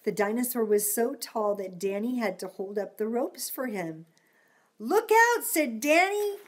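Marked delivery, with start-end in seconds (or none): 4.8-5.4 high loud warning shout; calling out. Acted. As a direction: yell a warning. Danny warns.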